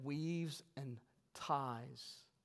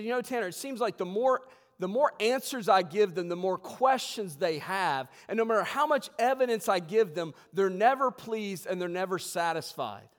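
loud, raised-pitch imitation of a complaint; retold complaint; 6 semitones higher, 11 dB louder than usual. Friend complains to preacher about resistant listeners, retold complaint. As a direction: retell a friend's exasperated grumble in a preacher's big voice. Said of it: "he was complaining about the fact".

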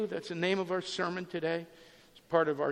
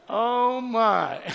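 mock dread; 6 semitones higher, 9 dB louder than usual. Next defendant dreads the judge.

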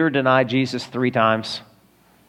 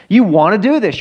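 repeated louder and higher, pressing "do"; emphatic repeat; 5 semitones higher, 5 dB louder than usual. Shown as a demonstration. Pastor urging would-be elders, louder and higher on "do". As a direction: drive it home the second time, punching "do".

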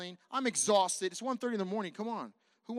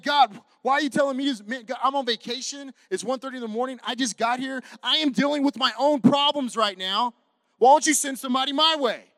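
loud, higher, pleading and fed up; loud exasperated plea; 7 semitones higher, 16 dB louder than usual. Retold or imitated. Tired pastor arguing with God at 1:30 am, loud exasperated plea. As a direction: complain loudly to God, worn out.

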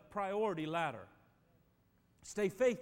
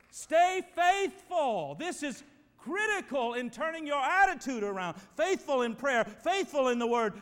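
preaching at full force; 4 semitones higher, 8 dB louder than usual. Acted. Pastor urging the church to stay faithful, loud preaching. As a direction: hammer each faithful home to the room.

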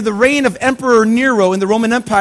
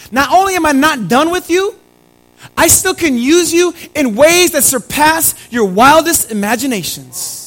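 louder and higher, building with fire; preaching God's promise; 5 semitones higher, 5 dB louder than usual. Acted. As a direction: proclaim it like God's own promise. Preacher voicing God, rising.

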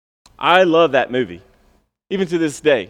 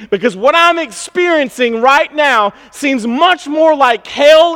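higher, louder, slowed demand building then easing off; Habakkuk's demand; 6 semitones higher, 4 dB louder than usual. Acted. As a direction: challenge God with mounting intensity. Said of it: "Habakkuk has a similar thing".

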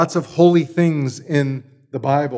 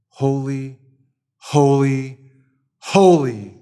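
each holy louder than the last, rising to a strong call; a triple holy that climbs to a proclaiming cry; building louder and louder; 3 dB louder, slower than usual. Shown as a demonstration. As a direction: swell the volume across the three holys. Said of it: "as it gets louder and louder".

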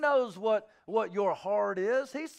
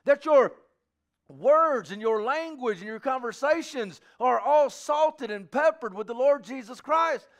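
high, loud, fervent preaching; preaching at full force; 8 semitones higher, 8 dB louder than usual. Acted. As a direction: drive the call home to the congregation. Preaches a charge to the church.

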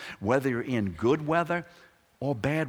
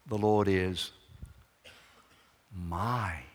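"my" set apart after a long pause; stress on MY; slower than usual. Shown as a demonstration. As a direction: hold back, then land "my" on its own. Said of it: "something emphasized before you get to the word".

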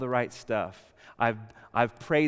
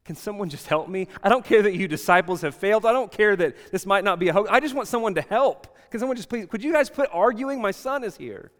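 higher, louder pleading father; desperate plea; 6 semitones higher, 6 dB louder than usual. Retold or imitated. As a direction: beg for help over the arguing crowd. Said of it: "He was surrendered nonetheless".

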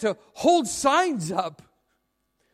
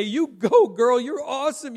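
spectator's cheer; 4 dB louder than usual. Acted. Spectator to a marathon runner, cheering. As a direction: cheer on a passing runner with a burst of volume.

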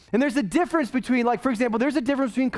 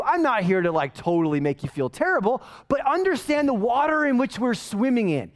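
ordinary talk

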